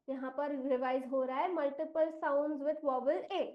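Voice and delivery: usual speaking voice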